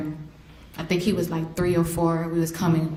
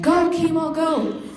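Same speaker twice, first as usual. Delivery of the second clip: cheering from the sideline; 10 semitones higher, slower than usual. Retold or imitated. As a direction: yell him down the field like a proud mom. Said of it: "screaming out his name".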